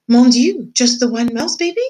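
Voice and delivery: put-on high, loud French-accented gasp; French mouse mother. French mother mouse hears of one surviving baby, French-accented high cry. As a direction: exclaim in a French accent, alarmed. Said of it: "She was a French mouse".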